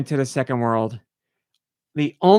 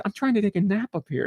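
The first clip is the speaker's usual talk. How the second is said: lightly voiced grumbling flower, higher and fast; talking flower; 6 semitones higher, 4 dB quieter, faster than usual. Flower to passing player disturbing its nap, lightly voiced complaint. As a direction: imitate a napper's complaint, quick and higher. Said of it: "There was one up there".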